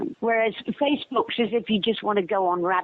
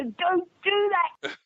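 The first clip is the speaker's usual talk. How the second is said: high, slow mock complaint from the actor; mock protest; 10 semitones higher, slower than usual. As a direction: drag it out high, playfully pleading. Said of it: "And he'd go".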